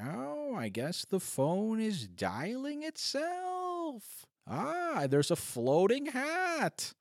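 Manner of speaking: high, slow, fake-amazed gasps; hammed-up wonder at cheap props; mock spooked visitor. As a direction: play a sarcastic tourist pretending to be impressed. Mock haunted-house visitor.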